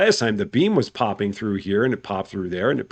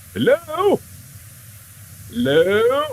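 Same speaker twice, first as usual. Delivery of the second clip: high, rising call of hello, going even higher on the second; calling out to a silent machine, much higher; much slower than usual. Real, not acted. Streamer calling out to the broken beam in a much higher voice.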